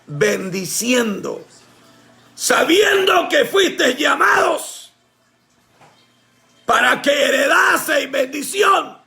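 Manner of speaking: slow solemn reading rising to a shout; scripture reading. Acted. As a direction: read the verse slowly, building to a cry. Reads scripture slowly.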